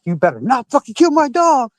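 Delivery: high, pressed warning yell; screaming warning. Retold or imitated. Pinned in ants, yelling at cops to spare Daisy, high warning yell. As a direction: warn them off the dog, yelled high. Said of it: "And I'm screaming at this point".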